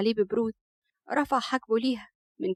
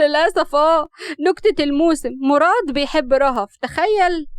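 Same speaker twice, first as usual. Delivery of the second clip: loud, higher-pitched ridicule; mocking; 4 semitones higher, 7 dB louder than usual. A narrator's reading. Rahaf ridicules Murad's love, mocking. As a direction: deliver it like a huge joke on him. Mocks his love after laughing.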